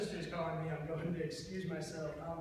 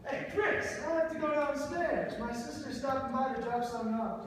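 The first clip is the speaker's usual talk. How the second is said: hurried burst in; 7 semitones higher, 4 dB louder than usual. Acted.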